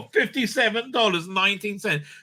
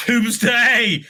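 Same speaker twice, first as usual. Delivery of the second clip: mock excitement; 4 semitones higher, 6 dB louder than usual. Real, not acted. Mocking a title.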